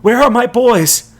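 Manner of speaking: high, loud desperate shout; a father shouting for his sons; frantic shout. A narrator's reading. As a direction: call out in panic. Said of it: "he shouts".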